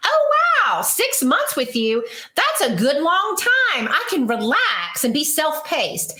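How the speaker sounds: high-pitched imaginary thrilled client; hammed-up pleased surprise, easing off toward the end; mock delight. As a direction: gush with exaggerated, put-on wonder. Acting an implausibly delighted client.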